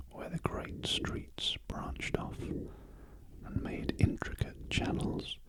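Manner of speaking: usual speaking voice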